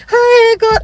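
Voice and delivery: high loud cry of joy; overjoyed exclamation; ecstatic cry. Retold or imitated. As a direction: shout to God in disbelief. Retold elation.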